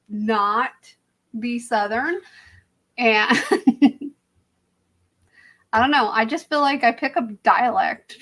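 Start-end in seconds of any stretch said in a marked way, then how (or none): none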